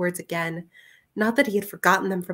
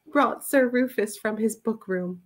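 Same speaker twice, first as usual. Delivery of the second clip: ordinary delivery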